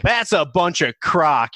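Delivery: high, loud, slow drawn-out scoff; dismissive street guy. Acted. Man on the street dismissing science, loud mock scorn. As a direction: drag it out loudly as the man on the street. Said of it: "And the guy's like".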